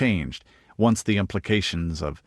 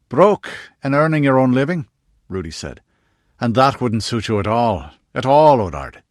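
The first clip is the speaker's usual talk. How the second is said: teasing; 4 semitones higher, 7 dB louder than usual. A narrator's reading. Rudy teasing Odard.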